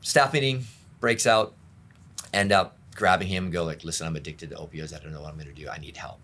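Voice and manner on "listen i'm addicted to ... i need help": low, hushed admission; very soft, lowered plea; confessing. Retold or imitated. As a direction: quietly own up and ask for help. Retold confession, grabbing the boss.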